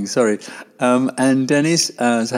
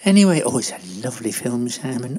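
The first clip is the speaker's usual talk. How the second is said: high-voiced Doris gushing over a film; rambling, delighted Doris; 6 semitones higher than usual. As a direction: gush fondly in a high, chatty character voice.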